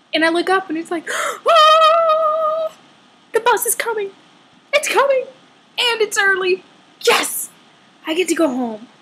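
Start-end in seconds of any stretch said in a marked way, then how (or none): 1.1-8.9 high, bright burst of joy; excited relief. Retold or imitated. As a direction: squeal it like rescue has come. Gasps seeing bus arrive.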